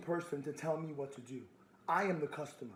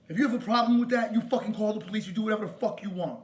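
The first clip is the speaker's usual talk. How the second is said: loud, raised-pitch dare; angry challenge; 3 semitones higher, 4 dB louder than usual. Real, not acted. Caller dares store worker to call police, angrily.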